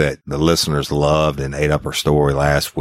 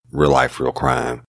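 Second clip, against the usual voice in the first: ordinary delivery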